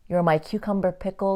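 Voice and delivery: ordinary talk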